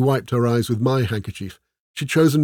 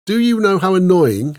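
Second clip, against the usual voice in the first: high, loud, exasperated outburst; irritated rhetorical question; annoyed interruption; 8 semitones higher, 7 dB louder than usual. A narrator's reading. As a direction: cut in sharply, fed up. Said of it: "she interrupted us".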